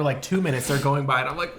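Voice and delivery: normal talking voice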